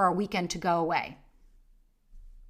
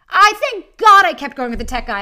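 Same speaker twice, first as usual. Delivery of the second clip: louder, higher thank-God relief; relieved exclamation; 7 semitones higher, 11 dB louder than usual. Real, not acted. Host telling listeners about the tech guy, loud relieved exclamation. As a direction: blurt it out with relief.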